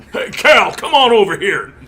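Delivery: loud, high gruff biker call; big growly biker voice. Retold or imitated. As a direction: bark it out like a burly biker. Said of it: "in that big growly biker voice".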